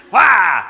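loud hammed-up baby cry "wah"; loud mock crying wail. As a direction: wail like a disappointed fan, jokingly. Team eliminated, mock wail.

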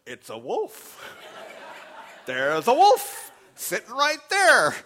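from a stunned murmur to a loud, high, slow gasp of disbelief; astonished realization. Retold or imitated. Pastor seeing the wolf outside the restaurant, growing astonished. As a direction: build from stunned to alarmed. Inner thought, growing alarm.

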